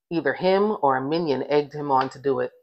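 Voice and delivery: ordinary delivery, nothing marked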